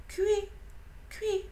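petit cri d'oiseau aigu, lent et triste; rouge-gorge imité. A narrator's reading. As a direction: imiter le chant d'un rouge-gorge, tout doucement. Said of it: "faisait un cui-cui d'hiver, un peu triste, un peu lent". (cui-cui d'oiseau aigu et lent)

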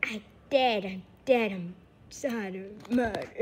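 usual speaking voice

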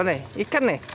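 ordinary talk, nothing marked

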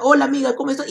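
put-on high sweet greeting to a girl; mock shy boy greeting. Boy greeting the girl he likes, high sweet voice. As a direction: say hi to her in a high voice. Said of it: "Mira, tú te acercas".

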